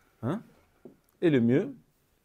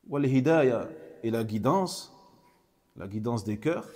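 ordinary talk

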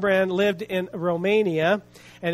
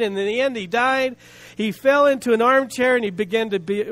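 usual speaking voice

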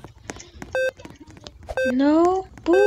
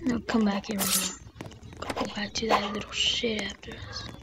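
hushed urgency; 6 semitones lower, 12 dB quieter than usual. Real, not acted. Player urging a teammate back, hushed whisper. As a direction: urge them back under your breath.

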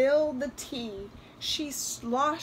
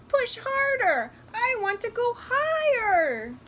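high, loud, demanding squeal; squealing doll; 12 semitones higher, 6 dB louder than usual. A narrator's reading. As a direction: squeal for more, stretching the end. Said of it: "the doll squealed".

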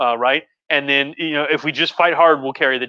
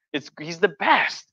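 usual speaking voice

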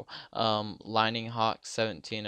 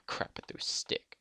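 ordinary talk

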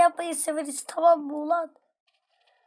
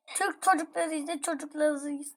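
ordinary talk, nothing marked

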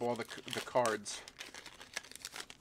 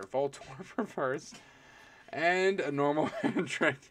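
laughing through words; 2 semitones higher, 3 dB louder, slower than usual. Real, not acted. Laughing while reading cards.

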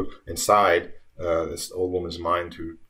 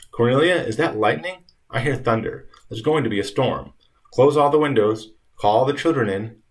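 raised, louder urgent voice, lightly acted; urgent calling out; 5 semitones higher, 6 dB louder than usual. Dying Granny calling to Cornelia about the storm, urgent. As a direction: call out orders as a storm comes.

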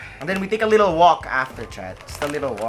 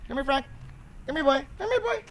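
much higher pet-calling voice; calling the dog; 11 semitones higher, 6 dB quieter, faster than usual. Real, not acted. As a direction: call the runaway pup back in a high singsong. Calling the running dog back.

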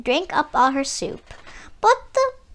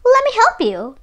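high, loud sly echo; mock echo of the fox; 10 semitones higher, 5 dB louder, faster than usual. A narrator's reading. Stork mimicking the fox's offer, sly and loud. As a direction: throw the fox's words back at him. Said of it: "just as Mister Fox had said".

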